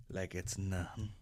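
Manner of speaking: ordinary talk, nothing marked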